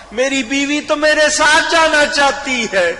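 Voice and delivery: high, loud happy announcement; خوشی سے بتانا; joyful news. Acted. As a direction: tell mother with delight. Son joyfully tells mother.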